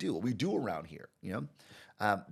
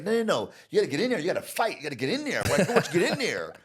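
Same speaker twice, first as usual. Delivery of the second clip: much higher, louder urging with a laugh; pushy client; 9 semitones higher, 8 dB louder, faster than usual. Retold or imitated. Argentinian client urging Marcus to fight, high loud laughing urging. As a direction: egg him on to fight. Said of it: "He's like".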